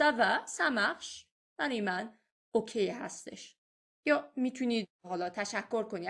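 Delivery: normal talking voice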